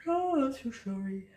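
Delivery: apologising through a real yawn; words stretched by a yawn. Real, not acted. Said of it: "I'm yawning over here".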